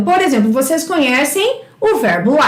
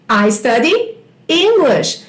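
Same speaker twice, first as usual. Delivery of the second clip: slow teacher demo; slower than usual. Shown as a demonstration. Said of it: "eu estudo inglês".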